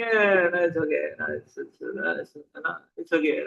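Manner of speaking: mock-casual dismissal, sinking low; nonchalant child. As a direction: shrug it off in a low mumble, imitating an unbothered kid. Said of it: "this fellow is singing".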